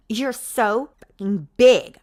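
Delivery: loud, slow complaint; complaining. Acted. Heroine complaining to her partner, loud and slow. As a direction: drag it out as a gripe. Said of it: "I don't say it to be sexy, but as a complaint".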